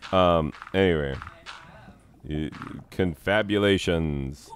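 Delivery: usual speaking voice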